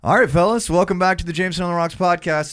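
loud, high announcer welcome; hyped show opener; podcast intro. Acted. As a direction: kick off the show like a host.

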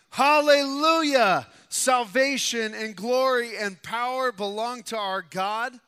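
ringing, drawn-out shout of praise, easing slightly; loud crying out. A narrator's reading. Preacher voicing the multitude in heaven, loud cry of praise. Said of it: "the loud voice of a great multitude in heaven crying out".

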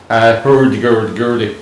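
mock Danish gibberish accent; hammed-up Danish accent. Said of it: "that was my Danish accent".

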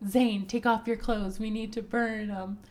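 mock urgent order. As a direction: bark out the order in retelling. Retold order to strip and burn.

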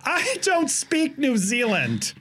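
high, slow mock-helpless delivery; feigned incomprehension. Passenger pretending not to understand the attendant, high slow feigned incomprehension. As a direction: play dumb, drawing out each word.

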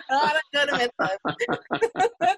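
laughing denial. Real, not acted. Laughing denial after being teased.

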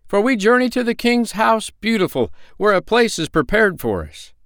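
very high, loud, eager; travelers' voice. Acted. Travelers explain to the man, high and eager. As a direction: tell him proudly where you go. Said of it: "cried the three".

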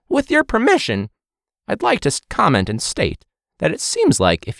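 high, mock-formal presenter voice; pompous formal voice. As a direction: put on a stuffy official tone. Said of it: "no personality".

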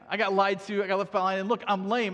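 Mephibosheth's excuse. Retold or imitated. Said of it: "he's basically saying".

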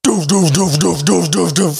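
high, rhythmic doofing cannon fire; evenly repeated vocal gun thuds; imitated gunfire. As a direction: mimic repeated anti-aircraft blasts. Imitating anti-aircraft guns firing.

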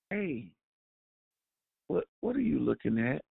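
a quiet, gentle question; gentle demo. Shown as a demonstration. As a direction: ask it kindly, almost softly. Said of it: "Do I gently explain to you".

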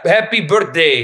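put-on slow Italian accent birthday wish; Italian immigrant accent. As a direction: say it like an Italian mom. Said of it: "I love the mispronunciation of".

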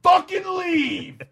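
high, loud furious yell. Retold or imitated. Buddy yelling as the kids get in their car, high loud shout.

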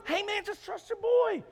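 high-pitched, hammed-up reassurance; insistent friend. Friend to the panicking man on the roof, frantically reassuring.

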